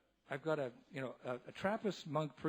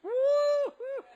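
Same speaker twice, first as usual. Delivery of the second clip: much higher, louder woo; high party whoop; drunken whoop; 7 dB louder than usual. Retold or imitated. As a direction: holler with giddy joy. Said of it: "but he was like".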